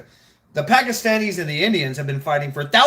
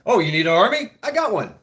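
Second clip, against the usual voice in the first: salesman pitch; 6 semitones higher, 5 dB louder, faster than usual. Retold or imitated. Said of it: "Like a".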